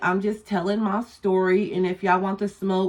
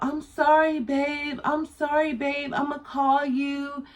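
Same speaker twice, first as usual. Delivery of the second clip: apologetic cousin; 6 semitones higher, slower than usual. Retold or imitated. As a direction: imitate the cousin sweet-talking, high and hushed. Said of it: "hella apologizing to him".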